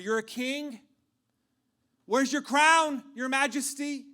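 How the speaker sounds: loud, high jeering questions; mockery. Soldiers taunt Jesus as king, loudly mocking. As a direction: taunt him with mock disbelief. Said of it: "They started with mockery".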